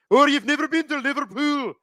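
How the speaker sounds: high-pitched, sing-out Scouse imitation; thick Scouse accent. Shown as a demonstration. Host demonstrating a TV show's Liverpool accents, mock thick Scouse. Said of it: "they've got the Liverpool accents".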